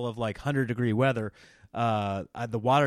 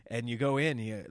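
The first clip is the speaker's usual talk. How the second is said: ordinary delivery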